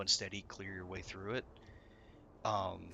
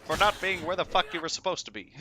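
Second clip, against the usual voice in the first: loud, raised and high ranting; mock angry raid leader; 8 semitones higher, 6 dB louder than usual. Raid leader scolding a player, loud mock anger. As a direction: bark it like a furious raid leader. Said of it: "he's like".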